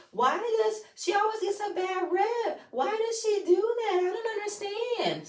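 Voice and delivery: very high-pitched imitation of clueless protest; high mocking quote; mock complaining. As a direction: mimic her bewildered complaint way up high. Mocking Sonya's defence of Marlo.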